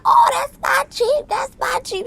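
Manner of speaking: Kimmy thrilled about her new Jeep, high squeal; squealing excitement. Retold or imitated. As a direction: squeal it like you just got a new car. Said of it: "She all crunk, she excited".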